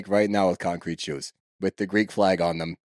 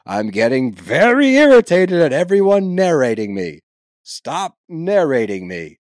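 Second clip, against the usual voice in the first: loud, fed-up snap at everyone; irritated outburst; 4 semitones higher, 10 dB louder than usual. Retold or imitated. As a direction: let her finally lose it, loud and fed up. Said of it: "Meredith finally snaps".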